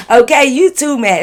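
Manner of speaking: giggling through the punchline; laughing. Real, not acted. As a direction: crack up at her own line. Laughing at her own joke.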